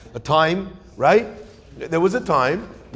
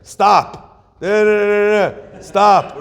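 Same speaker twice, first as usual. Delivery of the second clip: telegram imitation; 4 semitones higher, 6 dB louder, slower than usual. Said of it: "pay per letter on the telegram".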